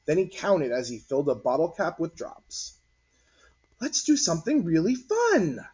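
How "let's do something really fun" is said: high, bright-eyed announcing of a fun plan; excited announcement. Acted. Cory announcing a fun idea to friends, high and eager. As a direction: raise the pitch and pitch the idea to the group. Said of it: "Cory announced".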